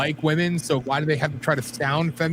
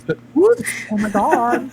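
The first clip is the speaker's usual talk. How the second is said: mock camp voice; 5 semitones higher, 5 dB louder than usual. Continues mock effeminate voice.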